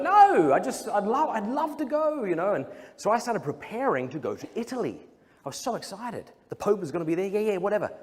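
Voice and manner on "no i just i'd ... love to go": much higher, eager protesting voice; pleading squeak. Retold or imitated. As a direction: plead sweetly with mum. Pleading reply to his mum.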